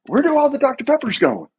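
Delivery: very high-pitched puzzled question; mock bewilderment. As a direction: imitate everyone asking in a comic falsetto. Everybody puzzled, acted in retelling.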